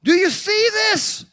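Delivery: high, loud, drawn-out holler; yelled out. Retold or imitated. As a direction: shout it across a packed church, imitating her. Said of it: "yelled out".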